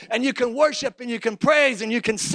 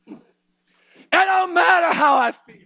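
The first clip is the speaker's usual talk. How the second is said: full-force preaching; 6 semitones higher than usual. Acted.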